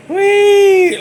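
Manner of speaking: loud, high pig squeal; mock pig squeal, long and piercing; Ned Beatty's squeal. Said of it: "poor Ned Beatty was like".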